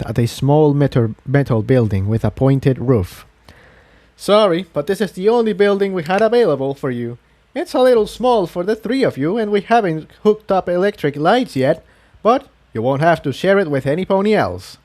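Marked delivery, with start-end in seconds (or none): 4.2-14.8 lightly raised, friendly village guide; a proud, devout host explaining life here; Quill Dipper's voice. A narrator's reading. Pious villager telling newcomers about the moon church, lightly voiced.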